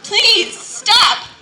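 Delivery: high, loud desperate plea; begging. Acted. Teacup begging the potter to stop, high loud plea. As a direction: cry out to make the pain stop. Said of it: "I begged him".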